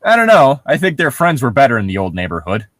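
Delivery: usual speaking voice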